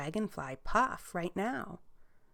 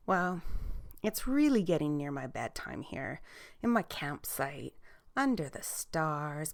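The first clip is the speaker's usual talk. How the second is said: ordinary delivery, nothing marked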